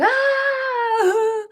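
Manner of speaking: high, imitating a kid's panicked wail; short high mock shriek; mock child wail. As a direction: squeal like a panicking kid. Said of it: "they're crying, freaking out".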